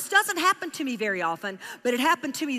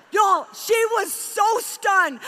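slow, high, loud exclamation; overwhelmed; 7 semitones higher, 4 dB louder, much slower than usual. Real, not acted. Preacher telling the congregation how stunned the woman was, slow and loud. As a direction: stretch it out, overcome.

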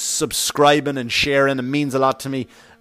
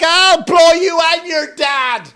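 mock trashy woman; 11 semitones higher, 4 dB louder, slower than usual. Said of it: "She didn't say, like".

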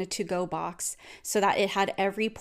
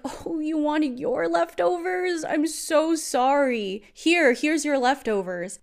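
high, slow mock sympathy; sarcastic; 7 semitones higher, slower than usual. Partner handing back the leftovers, sarcastic. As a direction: imitate a partner's exaggerated, drawn-out fake apology. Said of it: "He started being very sarcastic".

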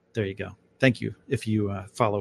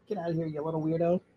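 playful pet talk; 6 semitones higher, 5 dB quieter, faster than usual. Real, not acted. Shooing dog playfully.

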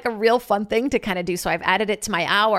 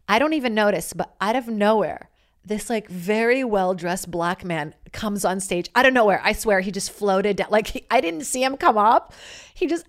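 normal talking voice

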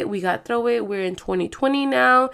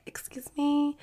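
sassy excuse me; 5 semitones higher, 9 dB quieter than usual. Retold or imitated. Retorting to imagined haters.

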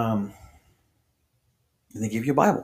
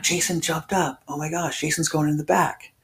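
higher-pitched imitation of amazed gossip; mock astonishment; 8 semitones higher than usual. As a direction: mimic girls marvelling, pitched up. Acting girls' surprised gossip.

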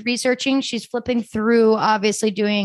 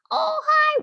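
cooing pet greeting. Real, not acted. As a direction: coo at a cat that wandered in.

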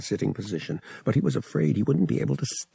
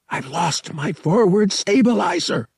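higher, louder alarmed call; alarmed cry; 9 semitones higher, 4 dB louder than usual. A narrator's reading. Pilot in failing ship, alarmed cry. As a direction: shout the bad news.

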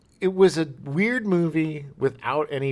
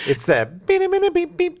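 mimicking synth music; 7 dB louder than usual. Imitating cliched soundtrack.

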